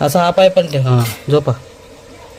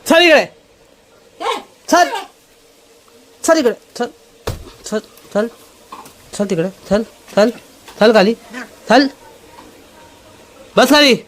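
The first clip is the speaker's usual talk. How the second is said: high, loud stern commands; scolding the dog; 10 semitones higher, 4 dB louder than usual. Real, not acted. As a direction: order the dog down, sharp. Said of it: "याला ना तो ओरडत पाहिजे". (कुत्र्याला ओरडून, जरबेने आज्ञा देणारा आवाज)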